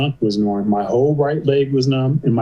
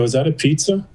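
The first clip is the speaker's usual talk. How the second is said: raised-pitch, feigned-oblivious question; deflecting question; 4 semitones higher than usual. Retold or imitated. Patient ignores friend asking about the hospital, deflecting. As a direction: dodge the question by asking your own. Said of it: "'Cause I'm ignoring him".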